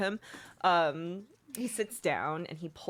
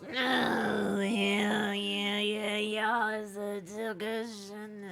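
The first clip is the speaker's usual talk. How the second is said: dismissive drawl; 3 semitones higher, much slower than usual. Acted. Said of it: "And he goes".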